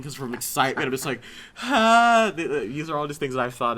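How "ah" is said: high, loud squeal of joy; excited shriek. Retold or imitated. The fan seeing Wolverine in the credits, shrieking with joy. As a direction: burst out like a thrilled fan.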